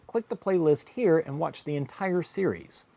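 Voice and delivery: ordinary talk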